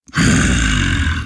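deep, drawn-out menacing hum; low ghostly hum. A narrator's reading. The phantom considers its move with a low hum.

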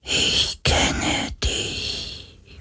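very slow, soft ghostly whisper; drawn-out, weak whisper. A narrator's reading. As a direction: whisper like a faint voice inside someone's mind. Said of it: "flüsterte eine Stimme durch seinen Verstand".

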